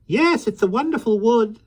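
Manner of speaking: put-on high, lively voice of a small old man; gentle, chatty little fellow; kindly little man. Mister Mo speaks.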